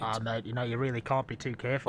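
low, soft words through a thick mask; muffled through a mask. Real, not acted. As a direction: talk from behind a face covering. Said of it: "You're muffled".

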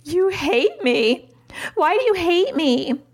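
high-pitched, drawn-out mock hurt; mock-distraught five-year-old accusing mom; imitating wounded child. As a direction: wail it like an overdramatic little kid. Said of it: "This is the reaction I will get".

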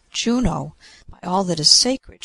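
loud, astonished exclamation; shouted. A narrator's reading. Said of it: "shouted Cupid".

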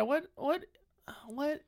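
normal talking voice

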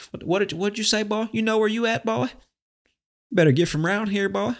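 badly faked Southern twang, pitched very high. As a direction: threaten like a cartoon Southerner, high and sustained. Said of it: "like, a stereotypical Southern accent".

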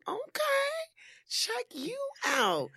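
high squeal of surprise; surprised squeal. Retold or imitated. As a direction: squeal it, impressed and teasing. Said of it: "I'm like".

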